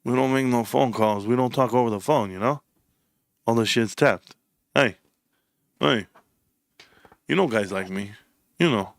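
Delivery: low, halting wiseguy mumble; Freddie's official mobster voice. Retold or imitated. Imitating Freddie saying no phone calls, low official mobster voice. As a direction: drop it low like a mobster avoiding phone talk. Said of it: "Freddie sounded real official".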